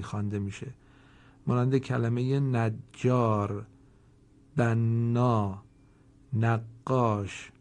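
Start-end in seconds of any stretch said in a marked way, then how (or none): 1.5-7.5 slow, each doubled consonant held and pressed; doubled letter held. Shown as a demonstration. Teacher showing students the doubled letters, slow and pressed. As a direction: press and hold the doubled letter in نجار, بنا, نقاش. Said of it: "در این سه کلمه ... فشار بیشتری آوردیم و مکث بیشتری کردیم".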